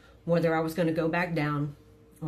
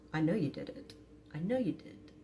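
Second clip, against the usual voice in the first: quiet accusation; 6 dB quieter than usual. Retold or imitated. Wife quietly telling husband she knows he cheated. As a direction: say it softly but firmly, knowing.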